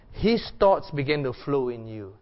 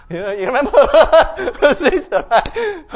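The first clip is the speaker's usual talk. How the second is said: laughing; 11 semitones higher, 12 dB louder than usual. Real, not acted. Laughs at his own Spock imitation.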